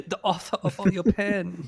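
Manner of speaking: laughing villain voice, Swedish tinge; hammy villain, slipping Swedish; villain impression. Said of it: "mine keeps sounding Swedish".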